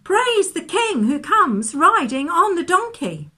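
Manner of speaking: high, loud cheer of acclamation; crowd shouting praise. A narrator's reading. People cheering the King riding in on a donkey, shouting praise. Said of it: "the people were shouting".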